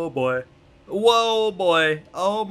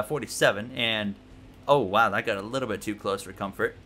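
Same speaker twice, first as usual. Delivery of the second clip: ordinary talk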